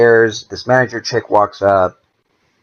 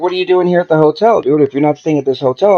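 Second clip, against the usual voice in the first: incredulous demand; 7 semitones higher than usual. Retold or imitated. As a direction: throw it back at him, baffled and annoyed. Confronting the boss at the hotel.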